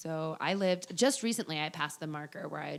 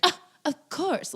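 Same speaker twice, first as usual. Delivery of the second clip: high, loud condescending scoff; snobby scoff; 12 semitones higher, 6 dB louder, slower than usual. Retold or imitated. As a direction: act superior about it. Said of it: "anybody who's gonna be like".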